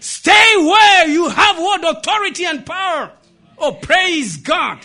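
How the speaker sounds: shouted, high-pitched exhortation; preaching. Real, not acted. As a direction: preach it at full force. Preaches the moral at full force.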